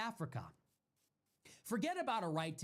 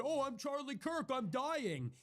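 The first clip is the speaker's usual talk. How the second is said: higher-pitched sneering mock voice; mocking the kid; 6 semitones higher than usual. Mocking the Texas kid's jeer at a dead man, sneering mock voice. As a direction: parody him jeering over the death. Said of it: "got up on a statue and said".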